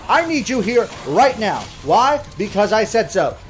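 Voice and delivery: scolding manager. Retold or imitated. Manager scolding an absent worker on the phone, exasperated. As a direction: chew out a no-show employee. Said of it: "I had to call them on the phone going".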